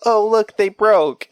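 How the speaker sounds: hammed-up brightness to cover the fright; playing it off. Dad covering the scary scene with fake cheer. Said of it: "I just played it off like".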